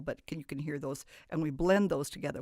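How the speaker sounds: ordinary delivery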